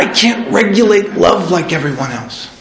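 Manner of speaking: ordinary talk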